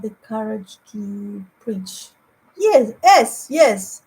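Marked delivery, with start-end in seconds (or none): none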